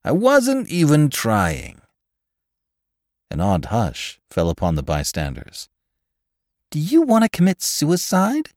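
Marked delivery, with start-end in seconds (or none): 0.0-1.8 slow, high indignant repeat; drawn-out indignant insistence. A narrator's reading. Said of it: "he explained indignantly". 6.7-8.5 very high, incredulous question; high-pitched outraged bystander; appalled scolding. A narrator's reading. Shocked bystander scolds the bad driver.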